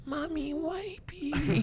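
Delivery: put-on very high little-child whimper; toddler voice. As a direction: call for mommy like a tiny kid. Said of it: "you hear these little pats on the door".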